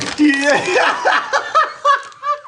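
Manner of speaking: very high squealing laugh; long helpless cackle; real laughing fit. Real, not acted. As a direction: lose it completely.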